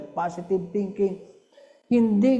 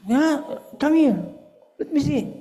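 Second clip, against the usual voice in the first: high, louder coaxing call; eager grandparent; 9 semitones higher, 4 dB louder than usual. Retold or imitated. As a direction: come here, let me see, pitched up. Grandparent asks to see grade.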